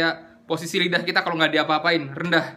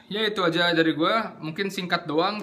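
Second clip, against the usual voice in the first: ordinary talk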